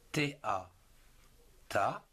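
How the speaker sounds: ordinary delivery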